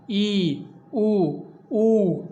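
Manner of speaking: ई and ऊ held long with lips nearly shut; closed vowel demo. Shown as a demonstration. Teacher showing students the closed vowels, drawn out. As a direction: model the closed vowels slowly. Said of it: "मुख लगभग बंद रहता है".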